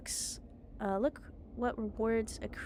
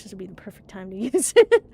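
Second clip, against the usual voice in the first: usual speaking voice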